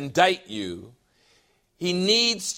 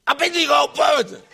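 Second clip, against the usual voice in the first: loud, fast, high mock Japanese accent; 9 semitones higher, 6 dB louder, much faster than usual. Preacher imitating an unbeliever in China, mock Japanese accent. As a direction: blurt it in a hammed-up Japanese accent. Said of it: "everything I do has a Japanese accent".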